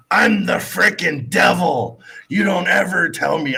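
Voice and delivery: imitating a woman yelling at a guard; mock yell. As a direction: play the ranting devil lady. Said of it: "She's in there screaming".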